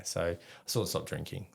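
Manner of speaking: ordinary talk, nothing marked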